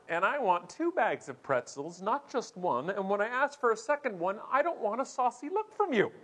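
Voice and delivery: high, petulant insistence; mock diva demands. Offended passenger demanding pretzels from airline, increasingly high mock indignation.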